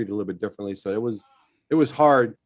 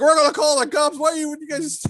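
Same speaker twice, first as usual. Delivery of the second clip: very high panicky threat; flustered old man threatening cops; alarmed neighbor; 3 dB louder than usual. Retold or imitated. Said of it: "he's like".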